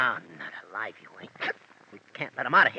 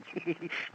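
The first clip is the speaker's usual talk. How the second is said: gleeful chuckle; 8 dB quieter than usual. Acted. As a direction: chuckle quietly, pleased with yourself. Henchman still laughing at joke.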